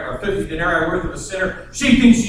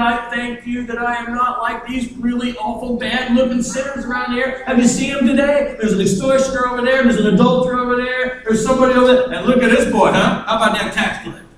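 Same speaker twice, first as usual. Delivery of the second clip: higher, self-satisfied praying; lightly voiced proud Pharisee; smug Pharisee; 6 semitones higher than usual. Pharisee praying and pointing at sinners, smug.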